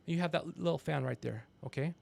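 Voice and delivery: normal talking voice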